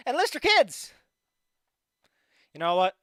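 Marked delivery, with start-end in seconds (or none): 0.1-0.9 high, loud ad shout; shouted slogan. Acted. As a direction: yell it like a recruitment ad.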